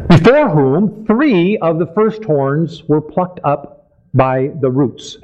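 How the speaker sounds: slow, deliberate Bible reading. Real, not acted. Preacher continues reading verse eight, slowly. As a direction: read the verse out unhurriedly. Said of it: "we want to read it slowly".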